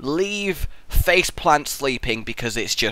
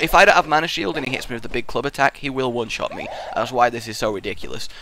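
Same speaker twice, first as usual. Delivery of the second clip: usual speaking voice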